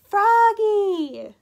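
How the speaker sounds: loud, high, drawn-out call; long shouted name, falling at the end. A narrator's reading. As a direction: call up to someone far away. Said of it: "far below calling".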